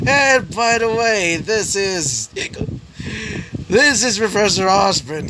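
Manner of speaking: put-on drunk crow. A narrator's reading. Drunk crow introducing the professor, high slow slur. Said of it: "Why did I say it like that?".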